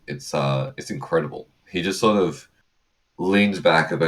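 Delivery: ordinary talk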